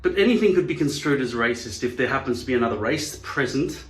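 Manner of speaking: normal talking voice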